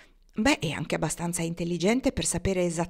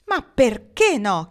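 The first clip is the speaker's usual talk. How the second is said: loud, higher, stretched demand; forceful question; 4 semitones higher, 9 dB louder, slower than usual. A narrator's reading. Pocket challenges Marnie's refusal, forcefully. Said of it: "chiese lui con forza".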